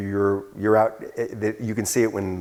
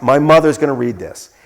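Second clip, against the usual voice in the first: louder, emphatic retold protest; deadly serious; 3 semitones higher, 7 dB louder than usual. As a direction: imitate someone in dead earnest, pushing the volume. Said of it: "he was deadly serious".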